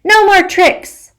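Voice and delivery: put-on a child's fierce defiant yell. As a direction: shout your resolve, building. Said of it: "I yell".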